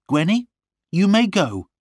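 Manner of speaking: high-pitched, controlled angry dismissal; contained anger. A narrator's reading. Lorna dismissing servant Gwenny, contained anger. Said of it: "her voice full of quiet anger".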